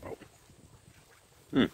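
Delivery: humming with mouth full; muffled closed-mouth murmurs; chewing a cracker. Real, not acted. Seen 0: Bites a cracker at -5, then lips closed, jaw working while humming.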